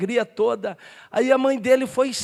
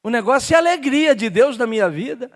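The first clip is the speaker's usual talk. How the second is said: ordinary talk, nothing marked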